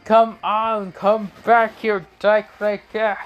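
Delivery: high-pitched, strained call after someone; mock screaming. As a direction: yell it high, without full volume. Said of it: "Kakashi is screaming".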